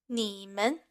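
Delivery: low dipping 你, short light 们; third plus neutral tone. Shown as a demonstration. Teacher demonstrating a listening exercise, low third tone on 你, neutral 们. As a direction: say 你们 low on 你, then a quick 们. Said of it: "first character has the third tone".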